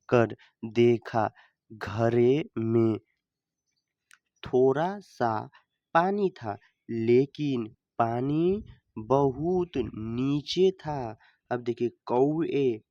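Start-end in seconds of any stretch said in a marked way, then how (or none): 0.1-11.2 put-on slow read-aloud for children; story reading. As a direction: read each phrase slowly, like a story for pupils.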